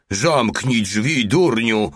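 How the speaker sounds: slow, mildly roared command; roared order. A narrator's reading. Voice inside ordering the intruder to shut the door, roaring. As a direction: order the fool to shut it, stretching the words. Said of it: "ryczał głos".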